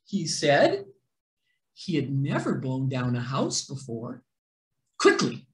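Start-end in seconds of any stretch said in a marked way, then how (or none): none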